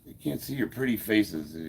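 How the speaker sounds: usual speaking voice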